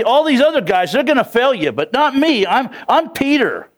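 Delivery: high-pitched, self-important bragging; acted cocky boast; boastful. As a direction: set yourself proudly above the others. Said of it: "Peter boasted".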